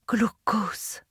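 breathy unvoiced whisper of his name; soft whispered name. A narrator's reading. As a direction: whisper it so he barely hears. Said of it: "chuchotai-je".

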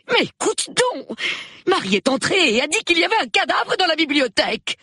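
very high, urgent wife; agitated insistence. Acted. Wife shakes husband to report a corpse, agitated.

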